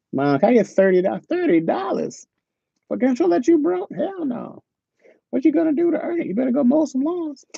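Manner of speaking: squeaky imitation of a scolding mom. Mom voiced in retold story.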